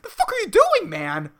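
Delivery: high, loud, comic outburst; angry retort. Retold or imitated. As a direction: fire back in exasperated mock fury. Acted angry reply to old person.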